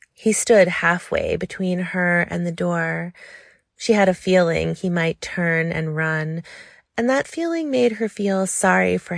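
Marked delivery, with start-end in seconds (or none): none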